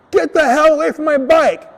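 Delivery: high, loud retold yell at a thief; angry yell. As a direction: yell to chase someone off. Retold yelling at bike thief.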